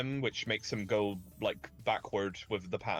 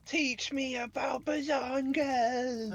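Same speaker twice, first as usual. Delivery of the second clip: high drawn-out voice; much slower than usual. Pretend. Mock request, drawn-out voice.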